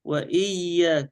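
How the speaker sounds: وإياك slow, doubled ya with no nasal hum; doubling without ghunnah. Shown as a demonstration. Sheikh models doubling without nasality, slow. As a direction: press the ya of "وإياك", keeping it oral. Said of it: "لكن تجديد بغير، بغير غنة".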